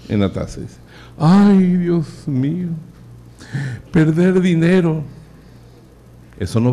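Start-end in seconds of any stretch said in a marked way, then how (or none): 1.2-5.0 hammed-up, drawn-out despair; anguished miser. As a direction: wail over lost money. Miser laments money in toilet.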